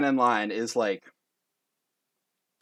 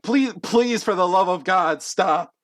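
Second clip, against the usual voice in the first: loud, high begging; desperate pleading; 7 semitones higher, 5 dB louder than usual. Acted. Judge begging contestant to stop the beating, desperate. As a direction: plead for it to end.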